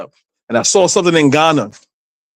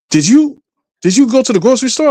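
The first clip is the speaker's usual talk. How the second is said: imitated mother, higher and quicker; mother questioning; 9 semitones higher, faster than usual. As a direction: mimic a suspicious mother's grilling. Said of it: "she was like".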